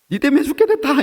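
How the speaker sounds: high, loud accusing cry; angry blame. Retold or imitated. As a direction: hurl the blame in panic. Disciples blame Jesus in storm. (폭풍 속 제자가 주님을 원망하며 외침)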